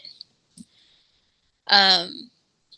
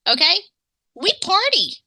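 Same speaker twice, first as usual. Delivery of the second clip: excited; 9 semitones higher than usual. Real, not acted. Boasting about Louisiana partying.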